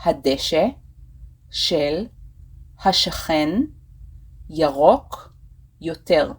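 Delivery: long pause after every word. Shown as a demonstration. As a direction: dictate it one word at a time for learners. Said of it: "I will say it again".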